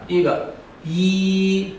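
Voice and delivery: 一 held high and level, slowly; first tone. Shown as a demonstration. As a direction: sing out 一 flat and high. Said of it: "第四个声调是一".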